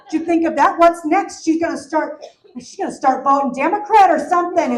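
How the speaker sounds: loud, high outraged mom rant; hammed-up fury at the daughter; indignant rant. Mom ranting to the pharmacist about her daughter, loud outrage.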